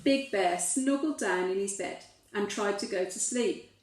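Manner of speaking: ordinary delivery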